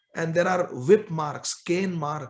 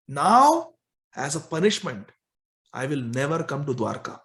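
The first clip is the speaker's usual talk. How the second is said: ordinary delivery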